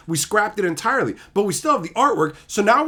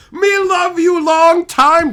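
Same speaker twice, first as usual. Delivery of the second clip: mock movie quote; 12 semitones higher, 11 dB louder, much slower than usual. Joking reply to Jess.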